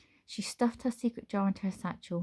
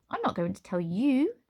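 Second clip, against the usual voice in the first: lilting higher sing-song; 5 semitones higher than usual. Acted. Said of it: "in a sing-song voice".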